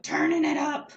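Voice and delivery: suddenly loud and high; loud excited. Retold or imitated. Joyce cranking heater, excited telling.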